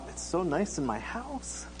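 soft, slow, lingering musing; wistful inner thought. Retold or imitated. Man longing to stay home from a picnic, wistful. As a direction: drag it out quietly, lifting at the end.

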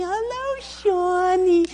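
very high sing-song "Hello, Shawnee"; sugar-sweet mom voice, overdone; saccharine mother. Retold or imitated. Mother gently waking her son for school, saccharine coo. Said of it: "she was so sweet and saccharine".

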